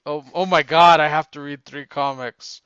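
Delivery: loud, hammed-up boomer complaint; overwhelmed boomer, volume pushed right up; boomer impression. Co-host groaning over three comics to read, hammed-up boomer impression. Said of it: "I was doing my Bomer impression".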